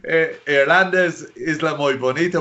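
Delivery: high, slowed joking Spanish; joking Spanish policeman. Retold or imitated. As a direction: imitate a jovial Spanish officer. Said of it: "He was joking".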